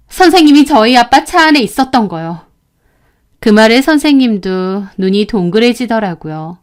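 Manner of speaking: ordinary delivery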